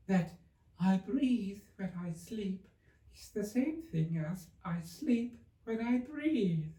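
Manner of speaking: high, very slow sleepy voice. A narrator's reading. Dormouse talking in his sleep, high and very slow. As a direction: drift through it half asleep. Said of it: "talking in his sleep".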